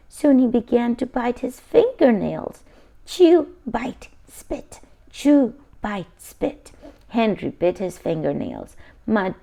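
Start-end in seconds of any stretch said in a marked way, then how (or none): 3.0-6.7 chewing sounds. A narrator's reading. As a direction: chew said out, bite and spit whispered. Chewing nails, whispered sound words.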